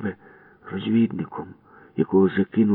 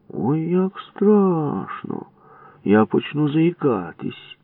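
much higher, slow mock fright; mocking fear; 9 semitones higher, much slower than usual. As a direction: ham up being scared. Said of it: "глузливо сказав Будка". (глузливий удаваний страх)

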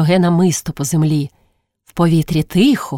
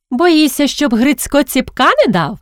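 high-pitched teasing jab; chirping taunt; 8 semitones higher than usual. A narrator's reading. Girl taunting her friend about a beating, chirping. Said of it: "цвіркнула Євга".

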